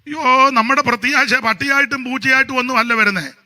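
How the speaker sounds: loud, high, fervent sermon delivery; full-force preaching. Acted. Preacher thundering to the congregation with fervent loud preaching. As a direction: preach it out to the whole church.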